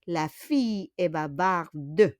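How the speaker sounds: "bavarde" ending on a clearly sounded "duh"; final E pronounced. Shown as a demonstration. Teacher repeating so they will know it's feminine, final E of "bavarde" pronounced. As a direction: let the E at the end of "bavarde" be heard. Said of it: "On prononce un peu de E".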